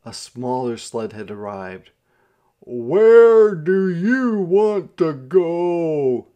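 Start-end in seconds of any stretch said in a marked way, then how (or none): 2.6-6.3 very loud, high, stretched-out holler. A narrator's reading. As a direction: holler each word slowly across the snow. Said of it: "the driver hollered".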